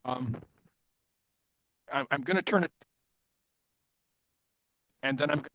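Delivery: choppy glitching internet call; words dropping out over bad connection; breaking up audio. Real, not acted. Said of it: "Your audio is breaking up".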